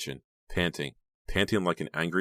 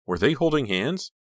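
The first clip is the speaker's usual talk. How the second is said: anxious disbelief; 8 semitones higher, 4 dB louder than usual. A narrator's reading. Becca's anxious inner question.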